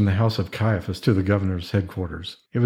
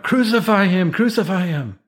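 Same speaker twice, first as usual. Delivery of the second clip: narrated outcry, pitched well up; crying out; 10 semitones higher than usual. A narrator's reading. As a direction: cry it out high, like a crowd demanding. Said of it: "they cried out".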